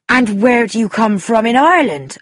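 put-on Irish accent, knowing and emphatic; Irish countrywoman gossiping. Guest gossiping about the landlady, knowing Irish voice. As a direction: confide gossip like an opinionated Irishwoman. Missus O'Rourke tells of her question.

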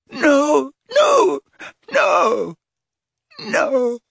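high, loud, breaking cries of no; anguished crying shout. A narrator's reading. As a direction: cry out in terror. Dumbledore screaming in torment.

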